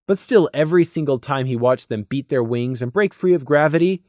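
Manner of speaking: ordinary delivery, nothing marked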